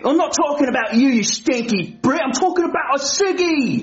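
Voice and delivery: put-on cheeky cockney-style British accent; mock London accent. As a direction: lay the British accent on thick. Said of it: "I don't like his accent".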